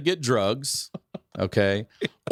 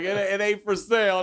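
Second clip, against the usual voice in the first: pitched-up, lightly hammed refusal; mock refusal; 12 semitones higher than usual. Narrator tells the stoplight buyers no, in a pitched-up mock voice. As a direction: brush them off in a raised put-on voice.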